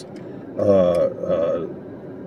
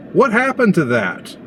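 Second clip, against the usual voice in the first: much higher, disbelieving repeat; incredulous; 10 semitones higher than usual. Real, not acted. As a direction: ask it again in real disbelief. Exasperated repeat of the question.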